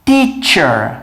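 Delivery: teacher said as tee-cher, middle of tongue; English word teacher. Shown as a demonstration. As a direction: model teacher clearly for repeating. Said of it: "muita gente pronuncia errado".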